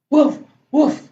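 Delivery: short, loud mock woofs; two punchy spoken woofs; puppy bark. Story reader barks as puppy Biscuit.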